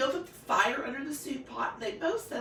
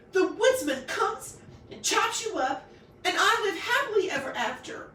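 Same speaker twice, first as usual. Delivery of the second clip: wailing; 10 semitones higher, 6 dB louder than usual. A narrator's reading.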